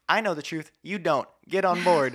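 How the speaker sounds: a hammed-up arrogant believer talking down; smug know-it-all. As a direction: lecture them loudly and smugly. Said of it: "walk in when you know the truth and say".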